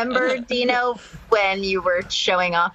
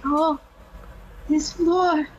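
higher, laughing Russian accent impression; 8 semitones higher than usual. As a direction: do Yakov's Russian accent, amused. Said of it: "he's like".

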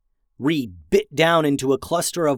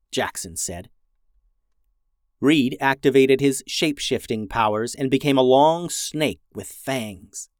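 plain reading voice

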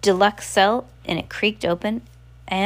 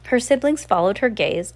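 plain reading voice

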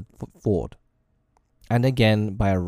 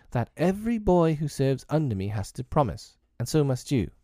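put-on boy captain; 6 semitones higher than usual. A narrator's reading. Peter lays down his rule.

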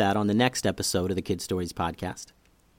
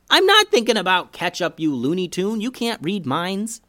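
higher-pitched, exasperated sibling retort; indignant protest; 8 semitones higher, 3 dB louder than usual. Acted. Brother arguing with his sister about mind reading, indignant. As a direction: protest hotly in a high, kid-like voice.

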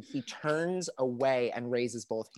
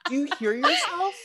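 high incredulous; 14 semitones higher, 5 dB louder than usual. Real, not acted. Incredulous at Savage.

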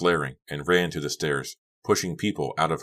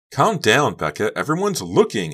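high, loud urgent plea; frantic appeal, pitched up; begging; 8 semitones higher, 7 dB louder than usual. A narrator's reading. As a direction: implore her to stop the scene. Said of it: "Honey begged".

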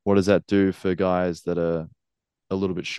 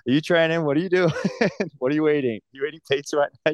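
high, teasing nag, pitched way up; nagging girlfriend; 9 semitones higher, faster than usual. Retold or imitated. Wrestler imitates girlfriend nagging him about training, in a high teasing voice. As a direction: play the girlfriend checking up on him, squeaky and accusing. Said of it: "just being like".

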